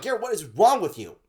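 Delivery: retelling the fight, a laughing mock scold; mock furious. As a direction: scold him half-laughing. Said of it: "I was furious".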